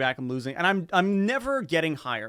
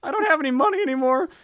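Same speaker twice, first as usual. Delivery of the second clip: high, sheepish plea to mom; sheepish kid; 11 semitones higher, 5 dB louder than usual. Retold or imitated. Broke birthday kid asking mom for more money, sheepish. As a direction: play the broke kid begging.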